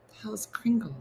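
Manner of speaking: breathy whisper; whispered aside. Real, not acted. Baffled by the Kringle story, whispering. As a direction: whisper it under your breath.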